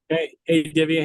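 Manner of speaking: ordinary talk, nothing marked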